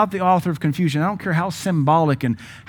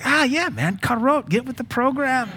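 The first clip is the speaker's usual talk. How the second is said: much higher, teasing impression; mock-scolding voice; 11 semitones higher than usual. Preacher imitates the lady chiding his carrot. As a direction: play the lady telling him to catch up. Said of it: "It's like".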